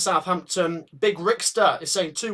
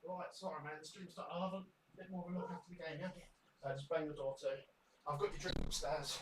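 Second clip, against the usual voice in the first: very faint, distant from the microphone; far-off background conversation; off-mic chat; 2 semitones lower, 21 dB quieter, faster than usual. Real, not acted. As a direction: talk to a guest out in the hallway. Greeting guest away from mic.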